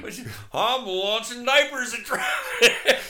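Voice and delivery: high, loud, slow laughing voice; goofy Florida man voice. Pretend. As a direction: play the proud lunatic. Voicing the diaper guy.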